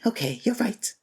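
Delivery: normal talking voice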